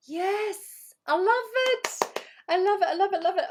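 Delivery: high, loud, gushing repeats of love it; thrilled. Real, not acted. Coach delighted by a participant's cancer-center dream, gushing loudly.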